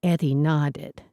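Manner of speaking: plain reading voice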